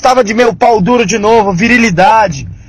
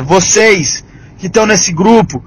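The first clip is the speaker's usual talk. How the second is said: ordinary delivery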